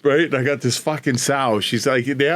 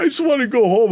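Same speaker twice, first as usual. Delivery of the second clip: much higher, whiny mock of the beaten man; mock whiny loser; 11 semitones higher, 3 dB louder than usual. Mocking the beaten husband who wants to leave, higher and whiny. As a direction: whimper it like a beaten kid. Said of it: "he's like".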